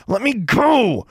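high, loud, drawn-out yell; demanding yell. A narrator's reading. Pinned girl to the custodian, demanding loudly. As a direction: yell to be released, stretching it out. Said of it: "Alexandra's voice was demanding at first".

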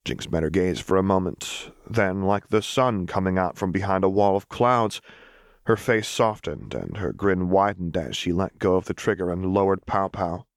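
ordinary delivery

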